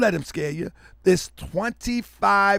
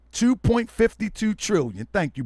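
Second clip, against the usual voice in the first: ordinary talk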